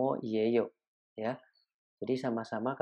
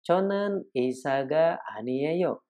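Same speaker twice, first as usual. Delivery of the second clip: slow Korean demo; 3 semitones higher, slower than usual. Shown as a demonstration. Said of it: "Contoh berikutnya".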